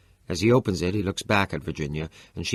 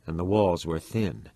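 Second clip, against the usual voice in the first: ordinary delivery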